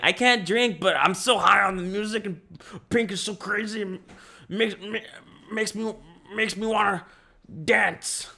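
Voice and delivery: high-pitched giddy teen imitation; mock party excitement. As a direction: play up being high on the music. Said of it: "That's how I felt".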